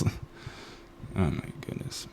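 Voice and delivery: breathy whispered oh my goodness; whispered disbelief. Real, not acted. Vlogger reacting to the movie, whispered disbelief. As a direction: say it under your breath in disbelief. Muttered aside after soap joke.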